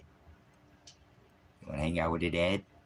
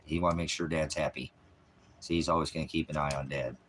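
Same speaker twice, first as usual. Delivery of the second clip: usual speaking voice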